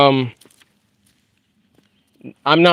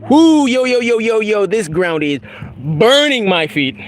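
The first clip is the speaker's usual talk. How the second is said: yelp; 8 semitones higher than usual. Real, not acted. Hot ground burns bare feet.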